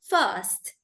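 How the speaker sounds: "first" drawn out with a silent R; British "first". Shown as a demonstration. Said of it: "They don't pronounce the R".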